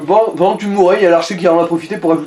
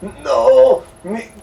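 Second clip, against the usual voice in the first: mock indignant shout; 8 semitones higher, slower than usual. Friend joking about his shaken politics, hammed-up indignant shout. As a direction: play the offended activist, over the top.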